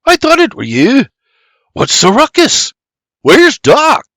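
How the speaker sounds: high, loud urgent questioning; startled character voices. A narrator's reading. Woken man and William, urgent exchange.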